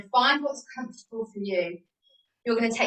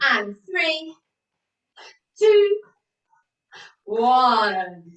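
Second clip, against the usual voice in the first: counting down louder and louder, last numbers called out; loud countdown; 6 dB louder, much slower than usual. Real, not acted. Fitness instructor calls the class countdown, rising to a shout. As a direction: build it up and punch the final count.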